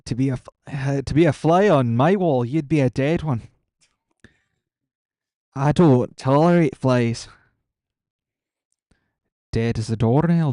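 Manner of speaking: loud nasal copied voice; imitating Felris. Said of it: "copy your voice".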